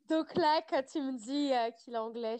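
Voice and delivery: parler encore secouée de rire, voix plus aiguë; en riant. Real, not acted. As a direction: finir de rire en reprenant la parole. Rire après l'accent anglais. (encore dans le rire)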